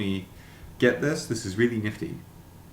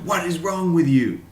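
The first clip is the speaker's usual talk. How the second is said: higher, louder, outraged shout; angry German viewer; 8 semitones higher, 7 dB louder than usual. Pretend. German viewers scolding his accent, mock angry. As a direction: yell it as an offended German. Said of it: "And much angrier".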